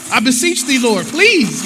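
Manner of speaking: put-on theatrical old-world pleading cry; Old Testament plea. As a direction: beseech the Lord in hammed-up King James drama. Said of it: "Old Testament and Old World on you".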